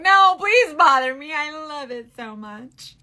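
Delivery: high, loud plea easing off into drawn-out softness; emphatic pleading. Acted. Answering people sorry to bother her, an exaggerated eager plea. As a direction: beg them, stretching the words. Acted reply to apologetic people.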